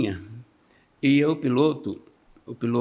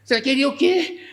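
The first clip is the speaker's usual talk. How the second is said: mocking shout; 13 semitones higher, 5 dB louder than usual. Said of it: "Aí ele falou assim".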